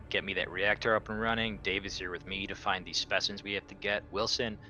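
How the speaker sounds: ordinary delivery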